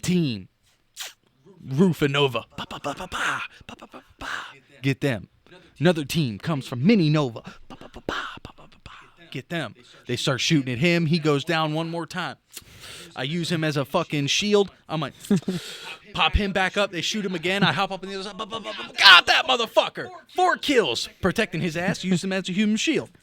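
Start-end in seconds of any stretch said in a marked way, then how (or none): 2.5-4.5 soft mouth gunfire, pa pa pa; imitated gunfire. Friend retelling a firefight, mimicking quiet gunfire. 7.7-8.9 breathy whispered ba ba ba shots; whispered gunfire. Retold or imitated. Recounting the second team's attack, whispered gun noises. 18.4-18.9 quiet mouth gunfire, ba ba ba; imitated gunfire. Narrating picking off enemies, soft gun noises. Retelling his four kills.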